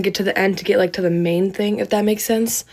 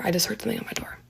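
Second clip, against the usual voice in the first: frightened hushed whisper; whisper, genuinely spooked; scared whisper; 12 dB quieter, much faster than usual. Real, not acted. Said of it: "I'm actually scared".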